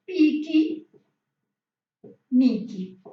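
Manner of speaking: piqui, miqui with an added final i sound; Portuguese-style pronunciation. Shown as a demonstration. Teacher showing students the Brazilian sound, loud demo. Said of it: "Y aportuguesada sería".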